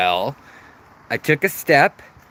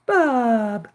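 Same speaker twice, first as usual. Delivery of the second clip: much higher cooing name; 10 semitones higher than usual. Real, not acted. Owner cooing fish's name in high baby talk.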